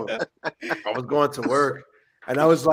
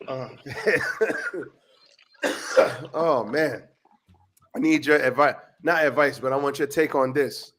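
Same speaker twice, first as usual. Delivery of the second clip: ordinary delivery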